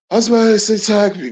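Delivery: fast, high, slurred-together lyric; crammed into one syllable. Retold or imitated. Host marvels at how he said it, words crammed fast. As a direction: rush it out as one squashed burst. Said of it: "One syllable. How you fit five words".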